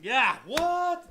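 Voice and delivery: loud, high yeah what; loud startled what; surprised exclaim. Real, not acted. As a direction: burst out in disbelief. Sudden exclaim at remark.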